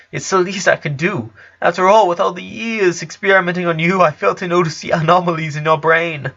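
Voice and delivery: put-on a small girl insisting on her guilt; guilty little genius. A narrator's reading. Lisa blaming herself, voiced higher.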